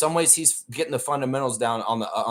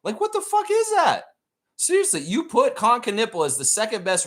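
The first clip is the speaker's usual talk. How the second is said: what the fuck pitched much higher in disbelief; incredulous outburst; 11 semitones higher than usual. Real, not acted. Streamer ranting at ESPN's rankings, shrill disbelief. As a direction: blurt it out, stunned by the list.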